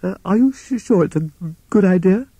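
high, stammering toad voice; nervous stammer. Acted. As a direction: stumble over it in a frightened high voice. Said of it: "he stammered".

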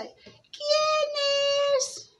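very high mock-granny voice; wolf as grandmother. Wolf in bed tricking the girl, high fake granny voice. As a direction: call out high, pretending to be granny. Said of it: "engañando a Caperucita".

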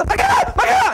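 very high, loud panicked yell; alarmed shout. Retold or imitated. Husband rushing to kitchen, shouting in alarm. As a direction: shout out in alarm, laughing through.

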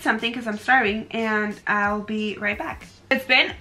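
lips barely moving; talking through tight mask; stiff face mask on. Real, not acted.

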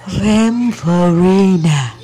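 very slow, drawn-out Vampirina; nói nhỏ nhỏ. Shown as a demonstration. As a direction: stretch the word out carefully, keeping it small. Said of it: "Rồi nói nhỏ nhỏ". (nói nhỏ, kéo thật chậm)

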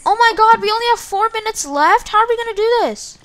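panicked "four minutes left?"; sudden alarm, voice jumps up. Real, not acted. Learns only four minutes left.

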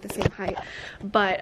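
usual speaking voice

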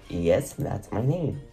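ordinary delivery

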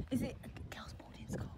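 hushed whisper, barely any voice; real soft whisper. Real, not acted. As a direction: whisper the question so nobody wakes. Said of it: "you were whispering like".

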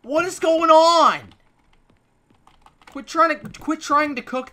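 loud, high shout; furious shout at the NPC; angry yell. Real, not acted. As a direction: yell at your roommate. Yelling at NPC.

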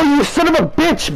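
high angry outburst at the opponent; furious yell. Real, not acted. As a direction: curse him out in a high yell. Raging at opponent in game.

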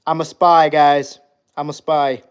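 normal talking voice

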